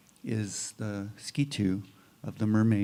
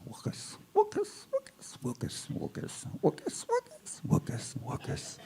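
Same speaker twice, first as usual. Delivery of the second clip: much higher, softer frog chirp, half voiceless; frog's croak; 7 dB quieter than usual. Acted. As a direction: chirp it like a little frog in the reeds. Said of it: "he says".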